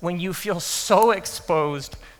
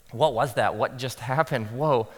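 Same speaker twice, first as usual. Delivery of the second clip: lower, softly dazed; dazed murmur; 6 semitones lower, 5 dB quieter than usual. Retold or imitated. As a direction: mutter it, shell-shocked. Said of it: "trying to kind of".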